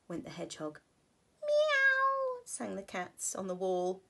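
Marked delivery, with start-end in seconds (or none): 1.4-2.4 high, loud meow; cat meow. A narrator's reading. Cats on the wall.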